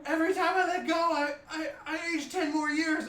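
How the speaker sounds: put-on high, loud, upset goblin teen; goblin teenager. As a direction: wail it as a panicked goblin teenager. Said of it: "And she goes".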